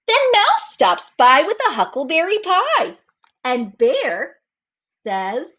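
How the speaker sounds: put-on slow, lively read-aloud for children; storybook reading. A narrator's reading. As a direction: read it like story time for little kids. Reads story narration aloud.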